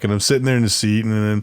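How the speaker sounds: ordinary delivery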